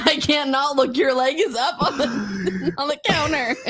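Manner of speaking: high, laughing through the words; laughing mock quote. Laughing while imitating the guys in the steam room. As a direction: squeal it out while cracking up.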